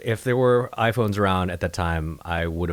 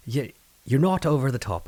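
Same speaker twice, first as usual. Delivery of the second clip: Irish caretaker; 6 semitones higher than usual. Retold or imitated. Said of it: "She said".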